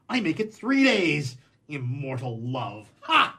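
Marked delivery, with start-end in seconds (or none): 3.0-3.4 sharp high scoffing laugh bark; scornful ha. Acted. Man scoffs at the girl's immortal love, scornful laugh. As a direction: scoff at the idea in one burst.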